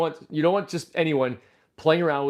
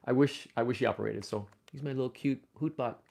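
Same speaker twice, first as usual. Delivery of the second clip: ordinary delivery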